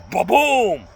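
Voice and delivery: loud ba-boom; crash sound effect. Retold or imitated. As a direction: slam it down. Flipping the attacker.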